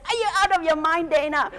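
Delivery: high, loud and fast indignant outburst; furious retort. Retold or imitated. Snapping at her friend Dana, loud, high and fast. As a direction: fire it back in outrage. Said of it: "I was more furious".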